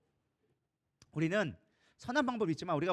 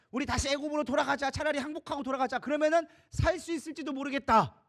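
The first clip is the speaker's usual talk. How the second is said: anxious people; 6 semitones higher than usual. Acted. People voiced pleading to return.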